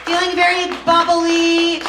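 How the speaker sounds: high, loud sing-song crowd hype. Acted. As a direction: belt it out playfully to the crowd. Host hyping the crowd, sung.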